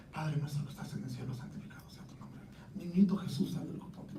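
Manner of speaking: a hushed, secret-sounding prayer; retold whispering of a memorized prayer; whispered prayer. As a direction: whisper the prayer under your breath. Retold praying alone, whispered.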